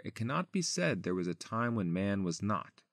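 normal talking voice

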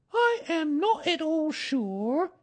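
slow, very high musing voice; high, deliberate pondering; thoughtful. A narrator's reading. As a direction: muse slowly in a high mother-animal voice. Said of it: "in a thoughtful voice".

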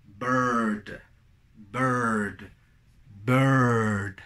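low, drawn-out "bird" with central er vowel; correct pronunciation. Shown as a demonstration. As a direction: hold the r-coloured vowel in "bird". ("bird" correcto, con vocal central alargada)

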